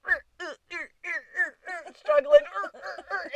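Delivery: high strained effort grunts; mock straining grunts; struggling grunts. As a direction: imitate a man straining to squeeze out. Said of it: "he's like".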